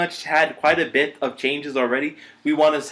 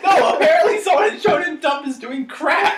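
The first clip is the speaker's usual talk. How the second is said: laughing; 10 semitones higher than usual. Real, not acted. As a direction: laugh while saying it. Laughing about crack pairing.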